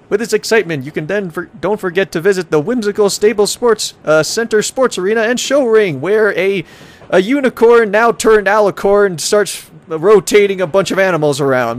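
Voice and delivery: high, upbeat brochure voice; tourism ad read. Acted. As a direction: sell each attraction like a promo. Reads travel brochure aloud.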